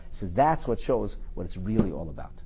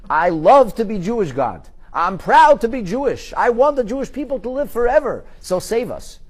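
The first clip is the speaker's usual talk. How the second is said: raised, higher proud declaration; loud proud declaration; 10 semitones higher, 8 dB louder than usual. Pretend. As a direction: proclaim your pride to God. Mock prayer demanding rescue.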